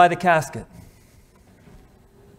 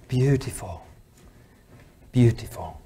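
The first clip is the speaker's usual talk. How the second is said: soft and low, fading to a whisper; hushed awe; 4 semitones lower, 7 dB quieter than usual. Retold or imitated. Preacher imagines God looking on a marriage, hushed. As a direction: sink reverently into a near-whisper.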